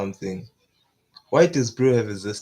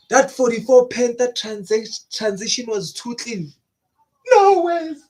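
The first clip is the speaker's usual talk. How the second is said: high-pitched, blown-away reaction; amazed disbelief; 10 semitones higher, 4 dB louder than usual. Real, not acted. Reacting to the Panther transition, high amazed disbelief. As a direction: marvel in a high voice, rising to a squeal.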